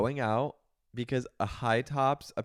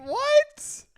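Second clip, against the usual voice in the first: high shocked shriek; 11 dB louder than usual. Real, not acted. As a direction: shriek it in disbelief. Shocked reaction to big news.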